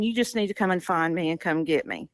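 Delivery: ordinary talk, nothing marked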